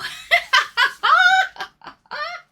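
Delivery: a sudden, loud, high laugh; high burst of laughter. Real, not acted. Streamer laughs after meow greeting.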